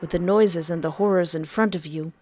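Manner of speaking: ordinary delivery, nothing marked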